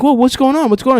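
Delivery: high startled whoa; mock bewildered kid; confused surprise. Jonathan walking in, confused.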